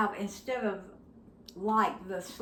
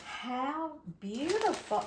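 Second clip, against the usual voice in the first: delighted gasp; 7 semitones higher, 3 dB quieter, slower than usual. Real, not acted. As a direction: gasp then slow, awed praise. Opening the gift.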